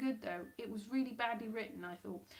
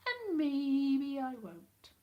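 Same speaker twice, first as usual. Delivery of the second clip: mock coyness; 4 semitones higher, 4 dB louder, much slower than usual. Real, not acted. Teasing the audience about next week.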